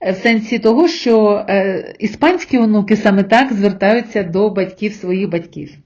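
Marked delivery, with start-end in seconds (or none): none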